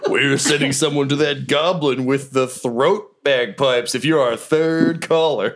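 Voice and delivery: high, slow laughing radio announcer. Pretend. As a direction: hype the caller contest. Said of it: "Sound like a radio D J".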